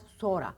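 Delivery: sonra as "soora"; stretched o in sonra; spoken form. Shown as a demonstration. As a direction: drop the n in sonra and double the o. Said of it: "N'yi at".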